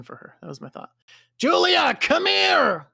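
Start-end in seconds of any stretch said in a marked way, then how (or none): 1.4-2.9 high, loud shout; full-voiced battle cry; urgent summons. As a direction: yell for an ally to come. Character calls ally in battle.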